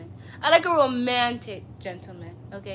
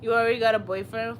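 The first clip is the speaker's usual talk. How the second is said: usual speaking voice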